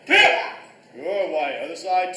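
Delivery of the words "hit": high, explosive martial arts shout; loud kiai yell. Real, not acted. Karate class yelling on each punch, loud kiai. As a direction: yell it hard as you punch. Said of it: "Loud T I".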